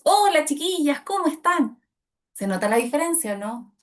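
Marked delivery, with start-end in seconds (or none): 0.0-1.8 louder, higher, projected; confident greeting. Shown as a demonstration. Coach showing a confident, projected greeting. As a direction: greet them with full confidence.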